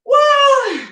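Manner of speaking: high, loud whoop of cheer; excited whoop. Real, not acted. Instructor cheering her class on with a high, loud whoop.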